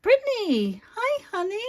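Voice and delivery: high, loud delighted call to her daughter; light up as she arrives; happy squeal of her daughter's name; excited greeting. Real, not acted. Daughter joins the live stream.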